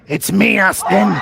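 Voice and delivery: loud hammed-up wrestling reveal; wrestling reveal shout. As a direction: boom it out like a wrestler unmasking. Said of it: "like something from W W E".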